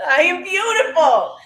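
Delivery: very high, delighted affirmation after a laugh; giddy, high-pitched declaration; exuberant conviction. Shown as a demonstration. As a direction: sing it out high with feeling. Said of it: "You say it with conviction".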